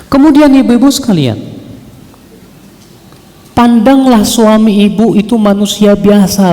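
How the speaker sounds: high, emphatic call to the congregation; preaching exhortation. Real, not acted. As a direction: exhort the listeners from the pulpit. (seruan ceramah yang tegas dengan nada tinggi)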